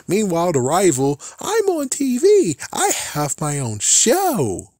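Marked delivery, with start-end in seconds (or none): 1.4-4.7 high, slow mock brag; bragging kid. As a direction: squeak it out like a smug show-off. Rival bragging, voiced mockingly.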